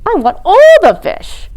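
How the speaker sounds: high, loud greedy demand; greedy little penguin. A narrator's reading. Polly grabs at dinner, greedy and loud. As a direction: burst in demanding it all.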